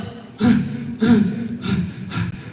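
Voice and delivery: long breathy sigh of defeat; exhausted sigh before giving up; weary sigh. Retold or imitated. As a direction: let out a worn-out sigh. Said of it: "you're like".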